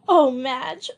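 high sobbing cry with a catch of laughter; exhausted sob. A narrator's reading. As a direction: sob it out, worn out. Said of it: "sobbed Joey exhaustedly".

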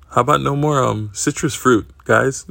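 sing-song mock scolding from the nurse; imitating a nurse ribbing them on the way out; teasing nurse. Nurse teases them leaving.

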